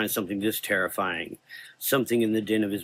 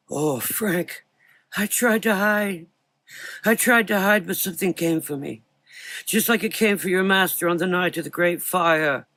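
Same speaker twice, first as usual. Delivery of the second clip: put-on frightened old man; 9 semitones higher than usual. A narrator's reading. Old doctor confesses his fear to Frank. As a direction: plead out of fear.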